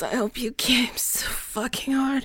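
soft, spent whisper; intimate afterglow. Pretend. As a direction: whisper it as if worn out.

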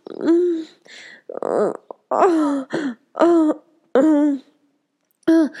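high moans of pleasure; climactic moaning. Acted. Climax of intimate scene.

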